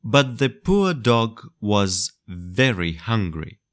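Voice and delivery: ordinary delivery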